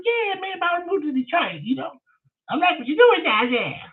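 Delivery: high squeaky voice for the caller; Leonard's voice. Retold or imitated. Retelling the strange caller, high mimicking voice.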